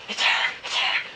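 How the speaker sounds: whispered to himself; excited whisper. Retold or imitated. Realising the girl is calling, an excited whisper. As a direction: hush it, thrilled. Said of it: "I'm all excited".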